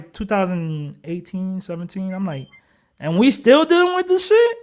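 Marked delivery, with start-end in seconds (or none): 3.0-4.6 high, louder incredulous protest; retold anger. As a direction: replay being mad, pitch shooting up in disbelief. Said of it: "I was mad".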